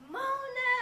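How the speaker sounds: excited call of a name, high and loud; retold greeting, called out brightly across the room. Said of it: "and he goes".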